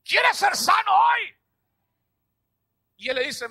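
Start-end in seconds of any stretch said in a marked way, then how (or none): 0.0-1.4 slow, high, loud shout of the question. Shown as a demonstration. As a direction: shout it out to the whole church. (pregunta gritada, lenta y aguda)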